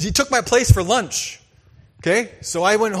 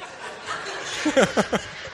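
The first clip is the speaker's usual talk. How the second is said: soft breathy laugh; 5 semitones higher, 5 dB quieter than usual. Real, not acted. Laughing after the punchline.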